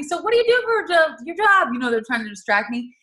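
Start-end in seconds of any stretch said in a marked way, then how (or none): 0.0-1.7 chirpy nurses. Retold or imitated. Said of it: "the ladies are trying to ask me".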